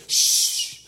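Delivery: loud, long hissed shush; hushing shush. Retold or imitated. Churches silencing talk that might offend, hushing. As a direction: shush the room forcefully.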